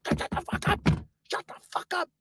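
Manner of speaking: harsh, voiceless warning; desperate whispered shushing; urgent whisper. Retold or imitated. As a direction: whisper it so the next table can't hear. Shushing friend near star.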